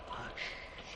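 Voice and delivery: whispered hush. Acted. Gloucester silencing everyone near the hovel, whispered.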